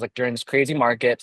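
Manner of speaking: usual speaking voice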